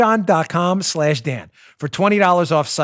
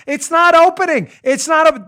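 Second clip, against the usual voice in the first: much higher, louder befuddled whine; mock dumb person; 12 semitones higher, 6 dB louder than usual. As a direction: play a fool stuck at a pull door. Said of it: "and you're like".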